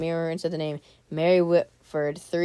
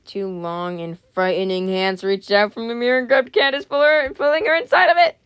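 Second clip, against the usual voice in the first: building excitement; 4 semitones higher, 6 dB louder than usual. A narrator's reading. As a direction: start near normal, climb to a high, loud finish. Reading builds as the hands grab.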